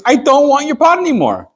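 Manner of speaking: excited announcement. Acted. Frank tells the buyer he is returning the pot, excited announcement. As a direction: exclaim it high as Frank.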